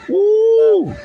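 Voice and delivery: a sudden high whoop; preacher's high whoop; high woo. Real, not acted. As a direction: let out a high excited woo. Preacher's exclamation.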